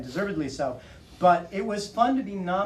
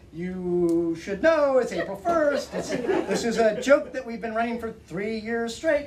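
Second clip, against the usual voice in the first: stretched-out "you should know"; mock exasperated explaining; 5 semitones higher, slower than usual. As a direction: draw out the opening, then jump high. Mock explaining to fooled readers.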